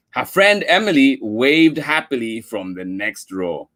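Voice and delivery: ordinary delivery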